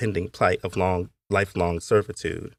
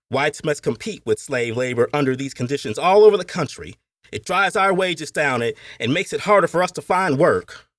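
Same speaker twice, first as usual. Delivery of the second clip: heated speech, much higher and louder; fiery oratory; 7 semitones higher, 4 dB louder than usual. Acted. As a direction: rouse the meeting hall with anger. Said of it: "The heated debating and fiery oratory".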